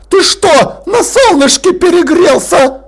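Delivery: pompous lord barking an order; growling Lord Rust. Acted. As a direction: snap the order like an outraged old aristocrat. Rust scolds Vimes.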